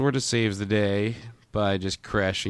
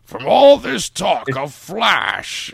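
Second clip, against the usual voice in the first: slow, high, louder mock-dramatic line; hammed-up exclamation; 9 semitones higher, 6 dB louder, much slower than usual. Joking about a dry throat from talking, hammed-up exclamation. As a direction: draw it out grandly, pitched way up. Hams up the name Flash.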